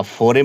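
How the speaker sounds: "फोरे" as two syllables, fo-re; wrong pronunciation. Shown as a demonstration. Teacher warning students off a misreading, "फोरे" with final e sounded. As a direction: read the final e in "फोरे" aloud. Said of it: "या फोरे मत पढ़ देना".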